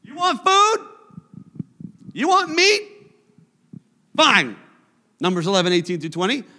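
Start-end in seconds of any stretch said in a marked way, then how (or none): 0.0-4.6 mock-exasperated God, pitched high on the questions; a fed-up God snapping back, sarcastic; angry God. Preacher voicing God's reply to grumbling Israel, mock exasperated. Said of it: "and so he says".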